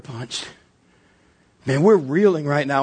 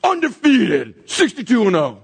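a brawler crowing over a knockout; triumphant yell; 6 semitones higher, much slower than usual. Acted. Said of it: "he's all".

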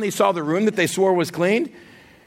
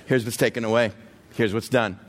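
ordinary talk, nothing marked

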